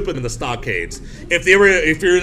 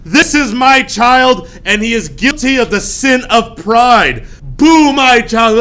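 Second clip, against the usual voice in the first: loud, higher, slow proclamation; public shaming; 7 semitones higher, 6 dB louder, slower than usual. Retold or imitated. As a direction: denounce your child to the whole square. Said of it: "drag him out in the middle of the town square and say".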